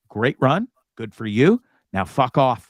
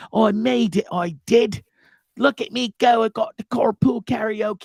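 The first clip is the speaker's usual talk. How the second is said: a smug mock British voice, pitched higher; gloating like a Brit who made it in America; mock British accent; 7 semitones higher than usual. Mocking Corden's gloating.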